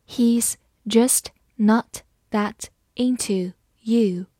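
slow, word by word, gaps between words; slow read-aloud. Shown as a demonstration. Teacher reading a film-title sentence for learners, slowly. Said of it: "好，我们慢慢来读".

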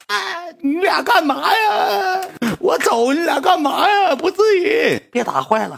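hammed-up high wailing shriek; very high, drawn-out mock tearful scream; crying girl's yell. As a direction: imitate a girl crying out to stop a fight. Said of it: "那女的后期搁网吧都哭了".

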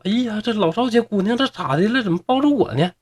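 high-pitched alarmed fluster; flustered, panicked. A narrator's reading. Mother suddenly hugged by neighbour's daughter, flustered and panicked. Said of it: "李母就惊慌失措".

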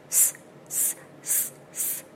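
"s，s，s，s，s" as separate voiceless hisses; each "s" only breath through the teeth. Shown as a demonstration. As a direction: repeat a pure unvoiced "s" with no vowel after it. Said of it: "首先要注意S清辅音".

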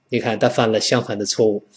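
normal talking voice

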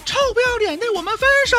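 high mock girlfriend yell. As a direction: shriek it as the angry girlfriend. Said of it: "女朋友在那喊".

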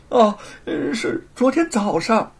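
high, hesitant, fearful reply; falter, then give up the answer; nervous stammer. Acted. Thief answering the interrogator, nervous stammer.

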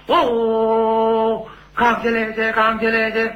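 high, drawn-out Peking opera call; high opera chant on stage; opera banner call. Retold or imitated. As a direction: sing it out like a stage attendant's cry.

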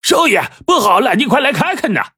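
high cracked yell; hoarse from yelling. Acted. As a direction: screech it out like a cracked gong. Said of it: "扯个破锣嗓子喊". (扯着破锣嗓子大喊)

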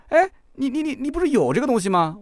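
high, startled stammer; doctor caught off guard by the report, stammering in surprise; surprised doctor. Retold or imitated. Doctor spots the hearing report, startled stammer.

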